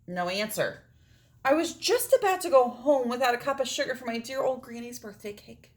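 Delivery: plain reading voice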